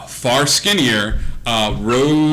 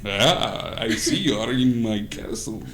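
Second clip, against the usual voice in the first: lightly voiced vampire host; 2 semitones lower, 6 dB quieter than usual.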